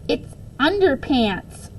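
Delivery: a classmate mocks the hat, mildly shrieking. Mild mock shriek. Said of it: "Chelsea shrieked".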